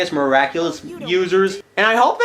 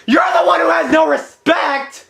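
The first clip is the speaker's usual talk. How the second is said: indignant shout; 10 semitones higher than usual. Acted. Angry comeback at the clip.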